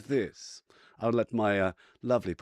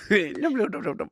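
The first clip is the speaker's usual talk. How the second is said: high, loud nomming squirrel; squeaky cartoon squirrel munching nuts; squirrel eating; 13 semitones higher, 8 dB louder than usual. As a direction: chomp it like a greedy squirrel. Player voices squirrel eating.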